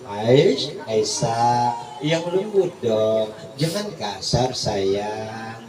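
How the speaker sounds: low, soft loving correction; gentle rebuke. Acted. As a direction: tenderly tell her to be gentle. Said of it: "Ditegur Nabi langsung".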